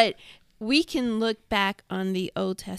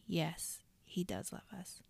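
whispered, much softer; hushed affirmation; 2 semitones lower, 12 dB quieter than usual. Retold or imitated. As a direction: breathe it out tenderly. Hushed quote of believer.

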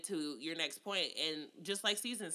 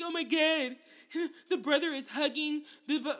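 mocking gushing quote; 7 semitones higher, 4 dB louder than usual. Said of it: "And everybody's like".

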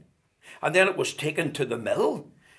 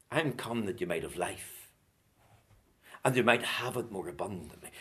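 low, soft preacher's voice; hushed scripture quoting; 8 semitones lower, 8 dB quieter than usual. Acted. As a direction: drop down and quote it solemnly. Preacher quotes scripture softly.

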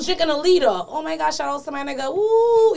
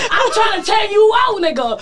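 loud, higher shouted boast; shouting; 7 semitones higher, 5 dB louder than usual. Real, not acted. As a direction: belt it out playfully. Repeats the line louder.

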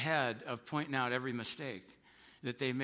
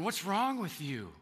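higher, exasperated mock rant; a touch of a resentful spouse, pitched higher; bitter mock outburst; 5 semitones higher than usual. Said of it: "you're going".

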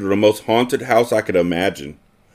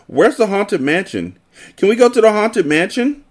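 eager pleading; 12 semitones higher than usual. Acted. Child begging to go on ride.

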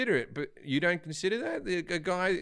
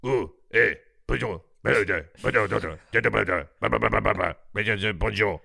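a lower, mumbled Québécois bonjour over and over; mock Québécois French; 6 semitones lower, 3 dB louder than usual. How French people hear French Canadians, in mock Québécois accent. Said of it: "they hear this".

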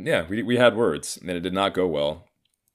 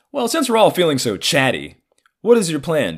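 louder, high, mock-polite question; sarcastic politeness; 9 semitones higher, 6 dB louder than usual. Son asking dad about his plan, sarcastic politeness. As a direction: needle him with pitched-up courtesy.

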